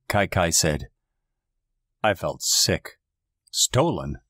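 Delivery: ordinary delivery, nothing marked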